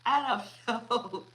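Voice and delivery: laughing through the words; can't hold the giggles; breaking up with laughter. Real, not acted. Reader cracks up at the reading.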